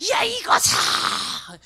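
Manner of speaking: slow, loud drawn-out scold; hammed-up parent yelling at a child; mother scolding her daughter, a mock loud slow yell; mock scolding shout. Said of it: "혼내킬 때".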